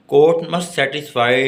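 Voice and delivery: normal talking voice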